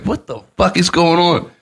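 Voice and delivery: high, baffled retold question; shared bewilderment.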